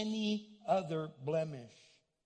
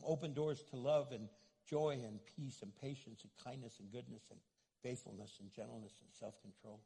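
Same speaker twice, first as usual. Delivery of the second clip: prayer; 5 semitones lower than usual. Acted. Closing prayer.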